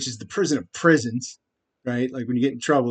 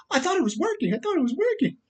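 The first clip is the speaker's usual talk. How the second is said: much higher, softer mocking whine of the poisoner; 13 semitones higher, 5 dB quieter than usual. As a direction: whine it like a baffled villain. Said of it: "the exact quote was".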